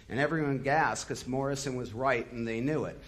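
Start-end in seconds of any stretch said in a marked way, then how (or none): none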